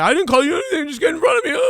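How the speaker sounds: a flustered man defending himself in a high voice; hammed-up high-pitched denial; mock flustered bystander. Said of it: "They'll be like".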